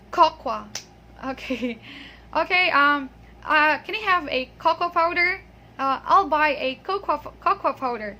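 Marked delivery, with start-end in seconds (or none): 0.1-0.7 cocoa said as "KOK-wa", loud first syllable; common mispronunciation. Retold or imitated. Teacher mimics what people always say, cocoa mispronounced. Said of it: "they always say". 3.8-8.2 "cocoa" said as "KOK-wa"; mimicked mispronunciation. Teacher imitates coffee shop customers, cocoa mispronounced. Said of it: "it's not cocoa".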